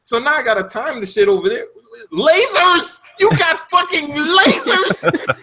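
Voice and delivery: high, disbelieving mock rant; incredulous rant. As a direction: rant like the level is unfair. Ranting at platforming section.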